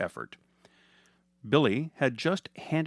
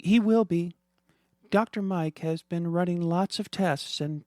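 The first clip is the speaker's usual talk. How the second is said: put-on higher, gentle mother soothing her child; reassuring mom; 7 semitones higher than usual. Mother reassuring her daughter about Dad.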